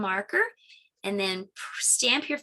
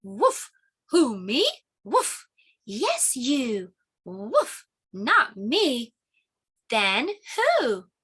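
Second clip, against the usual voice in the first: barking puppy voice, louder; playful puppy; 3 semitones higher, 3 dB louder, much slower than usual. Acted. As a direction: yap like an excited puppy. Puppy character with barks.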